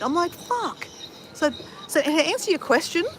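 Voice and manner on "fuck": high, quiet fed-up swear; annoyed curse. Retold or imitated. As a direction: spit it out, beaten and annoyed. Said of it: "I was so annoyed".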